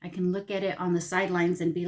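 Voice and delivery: ordinary talk, nothing marked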